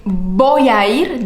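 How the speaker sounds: slow, "voy a ir" with each vowel separate; slow full form. Shown as a demonstration. Teacher showing the unfused form. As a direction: stretch "voy a ir" out word by word. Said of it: "En lugar de decir".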